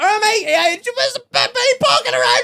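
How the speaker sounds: very high, strained mock holler; imitated shout. Driver calling out to labourers, high mock shouting. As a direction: holler to labourers from a moving car. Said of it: "I'm shouting at a couple of labourers".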